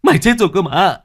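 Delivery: loud, high panicked shouting; terrified scream. Acted. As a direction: scream in terror at the ghost. Master screams at ghost.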